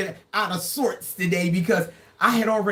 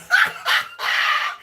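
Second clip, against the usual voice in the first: airy squeal of laughter. Real, not acted. Laughing at a viewer's guess.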